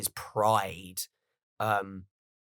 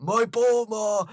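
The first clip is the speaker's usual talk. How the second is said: much higher, slow, drawn-out Vegeta voice; mock Vegeta; 11 semitones higher, 6 dB louder, much slower than usual. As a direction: stretch the words out in a put-on voice. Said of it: "You go".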